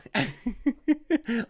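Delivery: high laugh at own joke; giggle, pleased with the bit; amused laugh. Real, not acted. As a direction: laugh at yourself after the yeehaw.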